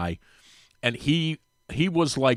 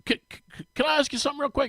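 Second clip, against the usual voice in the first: higher, hesitant stuttering ask; sheepish stammer; 11 semitones higher, 5 dB quieter, faster than usual. Retold or imitated. Starter sheepishly asking Drew a favour, stammering. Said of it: "And he's kinda sheepish".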